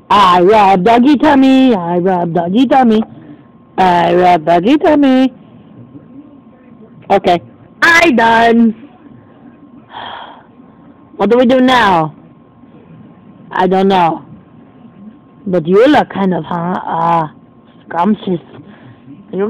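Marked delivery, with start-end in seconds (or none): none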